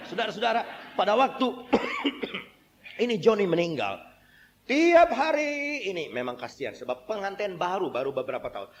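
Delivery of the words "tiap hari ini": long high wail, drawn out at half speed; mock-wail it, stretching the vowels; imitated wailing. Preacher mimicking a widow's daily grief, wailing. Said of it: "ini wanita dia nangis".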